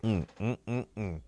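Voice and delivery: drinking while humming; mouth full. Real, not acted. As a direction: hum through a mouthful. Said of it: "apologize about that".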